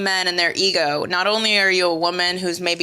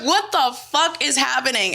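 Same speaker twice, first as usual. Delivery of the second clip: higher-pitched retold outcry; mock yelling; 6 semitones higher than usual. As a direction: exclaim it high in mock outrage. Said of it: "and I'm yelling".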